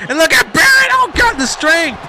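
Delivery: very high, loud outburst; astonished shout. Real, not acted. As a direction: cry out in disbelief at the lift. Commentator astonished by a lift.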